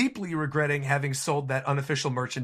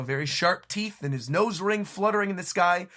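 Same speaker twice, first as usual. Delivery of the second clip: ordinary talk, nothing marked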